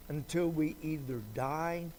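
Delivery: ordinary talk, nothing marked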